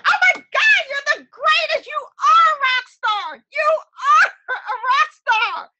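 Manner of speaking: very high, loud, thrilled praise breaking into laughter; gushing praise. Retold or imitated. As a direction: rave about her like a fan. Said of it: "my client was like".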